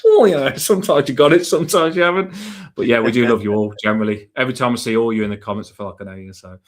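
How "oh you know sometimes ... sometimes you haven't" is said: laughing brush-off. Real, not acted. Laughing after being praised.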